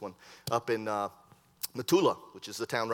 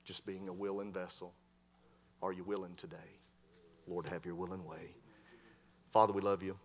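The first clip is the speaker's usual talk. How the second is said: prayer; 4 semitones lower, 6 dB quieter than usual. Acted. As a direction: pray quietly as the sermon closes. Closing prayer and altar call.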